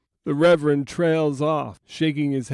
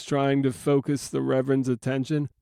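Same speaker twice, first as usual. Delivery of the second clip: usual speaking voice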